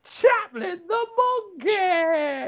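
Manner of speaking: high, slow shouted puppet monkey name. Acted. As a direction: proclaim it slowly as a squeaky monkey. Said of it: "I don't know where the accent was going".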